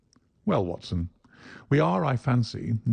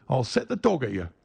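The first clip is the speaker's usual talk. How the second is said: threat; 4 semitones higher than usual. Acted. Salesman threatens the little man.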